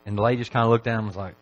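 usual speaking voice

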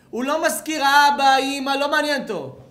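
high, loud shout building to a scream; impassioned shouting. Retold or imitated. Preacher describing a hungry baby to listeners, impassioned shouting. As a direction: cry it out at the top of the voice.

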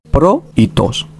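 "pro y tos" split into two separate syllables. Shown as a demonstration. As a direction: say "pro", then "tos", each syllable set apart by "y". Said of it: "está conformada por dos sílabas".

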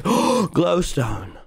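ordinary talk, nothing marked

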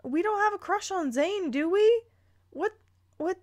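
ordinary talk, nothing marked

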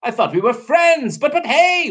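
high, flustered storybook voice; stuttering protest. Acted. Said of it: "Waffle stuttered".

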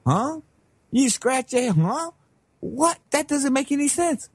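mock confusion, voice going up; puzzled. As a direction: act baffled, pitch lifted on each question. Acting out a puzzled listener.

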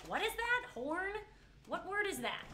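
incredulous high questioning; baffled reaction. Retold or imitated. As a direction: act out total confusion at a strange word. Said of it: "You're like".

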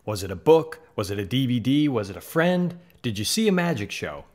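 normal talking voice

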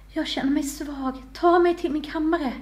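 high, slow, pained voice; faint king's groaned plea; groaning. A narrator's reading. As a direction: moan it out as if wounded and weakening. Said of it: "stönade kungen".

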